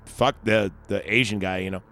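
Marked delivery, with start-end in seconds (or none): none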